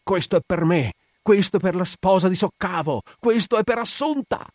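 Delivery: high, raging cries, rising with each blow; blind fury. A narrator's reading. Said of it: "Il muratore accecato inferociva". (furia cieca, grida in crescendo)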